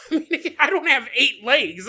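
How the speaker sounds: laughing loudly through the words; cracking up mid-sentence. Real, not acted. Laughing to the audience about having no eight legs.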